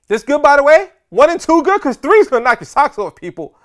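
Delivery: loud, high comic shout; Bernie Mac impersonation. Retold or imitated. Comedian hyping the seminar crowd, loud high Bernie Mac shout. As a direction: belt it out like a hyped stand-up comedian. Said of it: "That's my Bernie Mac impersonation".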